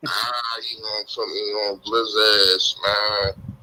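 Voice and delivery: usual speaking voice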